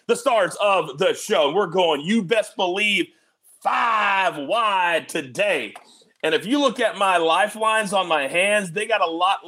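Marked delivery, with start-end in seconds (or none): 3.6-5.7 drawn-out hype call. Acted. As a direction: boom it out like a ring announcer. Hyping the guests' entrance.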